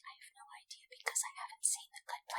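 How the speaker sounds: hushed whisper, high and breathy. Pretend. Friend whispering the punchline to her friend, whisper. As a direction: whisper it like a confession. Joking aside about not having seen.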